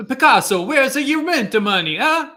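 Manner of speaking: loud, high, gruff impatient scolding; hammed-up Italian accent, demanding rent; Italian landlord accent. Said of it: "my Italian Pablo Picasso landlord impression".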